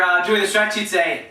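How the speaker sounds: ordinary talk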